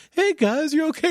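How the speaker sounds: put-on very high, gentle old-man voice; sweet old man. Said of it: "He's like".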